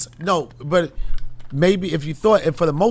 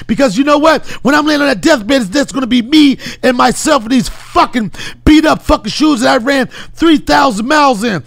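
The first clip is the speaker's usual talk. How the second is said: forceful, raised motivational rant at full tilt; David Goggins impression. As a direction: berate yourself into changing. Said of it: "incredible David Goggins, uh, impression".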